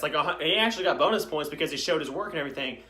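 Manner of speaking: normal talking voice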